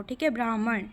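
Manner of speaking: usual speaking voice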